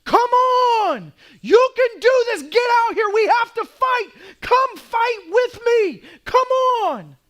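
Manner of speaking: top-of-lungs rallying scream, high and loud; screaming at them. Retold or imitated. Officer in the street screaming to his men, full scream. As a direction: roar them into the fight. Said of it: "he starts screaming at them".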